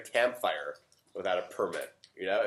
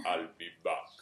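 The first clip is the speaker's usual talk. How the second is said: lower, softer mock action-hero voice; a hint of the movie catchphrase; lightly voiced Terminator line; Arnold Schwarzenegger; 5 semitones lower, 5 dB quieter than usual. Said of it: "you know Arnold Schwarzenegger?".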